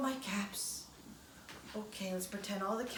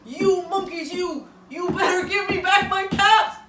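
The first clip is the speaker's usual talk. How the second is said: loud, high furious shout; angry shout; 9 semitones higher, 14 dB louder than usual. Acted. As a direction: stamp a foot and shout at them. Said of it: "I'm gonna stamp one foot".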